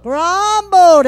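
Grumbled called out loud and high. Shown as a demonstration. Pastor leading the church in saying the word, loud. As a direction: belt out Grumbled. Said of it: "Everyone say".

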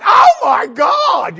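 loud, high, drawn-out yell; mimicked exclamation. People say it everywhere, hammed-up loud shout. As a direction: yell it like an astonished bystander. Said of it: "they're going".

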